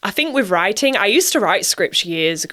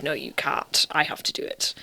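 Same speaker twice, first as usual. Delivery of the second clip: muttered crew quote; 4 semitones lower, 9 dB quieter than usual. Retold or imitated. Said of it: "people are like".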